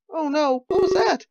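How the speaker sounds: put-on high, alarmed young man fearing the worst; panicky worrier. Nervous passenger panicking to his seatmate as the train halts, high and alarmed. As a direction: squeak it out like it's the end. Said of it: "one of those catastrophic thinkers".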